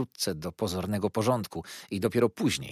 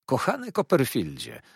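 put-on a happy, raised greeting to an old pupil; glad call; 5 semitones higher, 3 dB louder than usual. A narrator's reading. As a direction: call his name out with joy. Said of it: "zawołał". (radosne zawołanie, wyraźnie głośniej)

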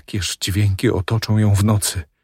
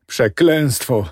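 pained cry, raised pitch; anguished curse; 5 semitones higher than usual. Acted. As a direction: curse it in despair. Husband cursing in despair.

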